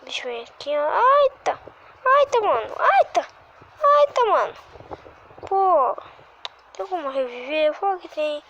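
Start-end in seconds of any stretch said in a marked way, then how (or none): 0.9-6.0 high, loud squealing outburst; frustrated squeal. Real, not acted.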